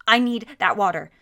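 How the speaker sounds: very loud, furious demand; angry shout. A narrator's reading. Vagrant threatens.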